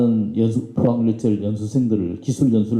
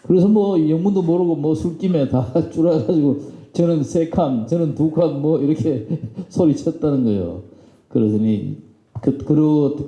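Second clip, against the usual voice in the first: normal talking voice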